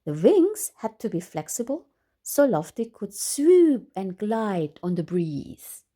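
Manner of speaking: lively, animated storybook reading with big rises; bright exaggerated read-aloud voice; reading to children. A narrator's reading. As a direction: read it out like to a room of kids. Reads picture book to children.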